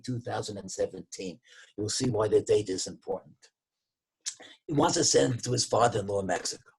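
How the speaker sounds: ordinary talk, nothing marked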